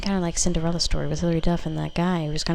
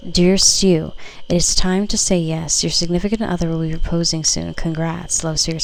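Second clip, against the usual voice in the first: loud, announcing reading of the card; card message read; 7 dB louder than usual. Acted. Tarot reader announcing a proposal card to viewers, loud and proclaiming. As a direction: proclaim the card's news to the viewer.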